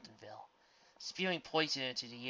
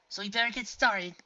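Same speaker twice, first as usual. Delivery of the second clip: character voice; 8 semitones higher, 9 dB louder than usual. A narrator's reading. Character voice in read story.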